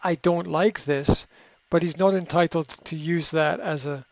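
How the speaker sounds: usual speaking voice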